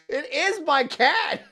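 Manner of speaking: high, loud, drawn-out exclamation; amused outburst. Real, not acted. Streamer telling honey about the cat joke, amused outburst. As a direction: exclaim it slowly and high between laughs.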